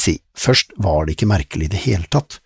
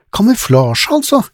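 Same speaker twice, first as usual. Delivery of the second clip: put-on girl's voice; 8 dB louder than usual. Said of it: "innskjøt Lizzie".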